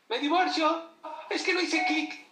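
hammed-up high complaint; mock whiny excuse. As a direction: parody a careless parent's flimsy excuse. Mocking a divorcing parent's excuse.

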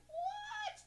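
squealing stunned question; shocked what. Retold or imitated. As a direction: gasp it in total disbelief. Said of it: "I'm like".

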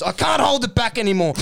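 high-pitched mock cry; acted outburst. Duck losing control before the fight, acted outburst. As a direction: burst out as if self-control snaps.

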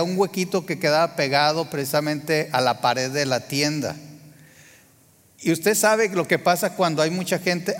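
usual speaking voice

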